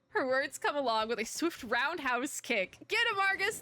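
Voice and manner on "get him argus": high, loud burst of cheering; root for her like a fan; excited cheer. Real, not acted. Player cheering her character's kick, excited.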